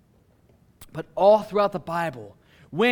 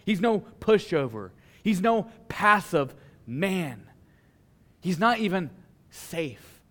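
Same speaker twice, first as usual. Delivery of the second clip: ordinary delivery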